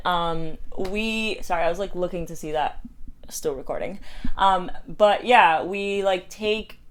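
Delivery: usual speaking voice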